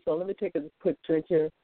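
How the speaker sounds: ordinary talk, nothing marked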